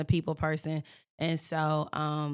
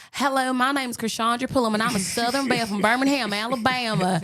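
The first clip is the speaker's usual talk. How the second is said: put-on Southern belle; 4 semitones higher, 4 dB louder than usual. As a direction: introduce yourself as an exaggerated Southern belle. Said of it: "that's what everybody thought I was supposed to sound like".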